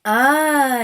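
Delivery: loud, high burst of excitement; excited exclaim. Acted. Sister hearing the right answer, excited exclaim. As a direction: gasp it out, thrilled. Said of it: "exclaimed the sister in excitement".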